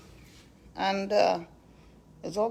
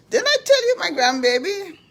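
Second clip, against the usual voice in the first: doting; 11 semitones higher, 6 dB louder than usual. Real, not acted. Doting on grandbaby.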